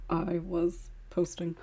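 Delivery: whispered aside, slow; hushed while distracted by the script; whispering an apology. Real, not acted. Posting script while half listening.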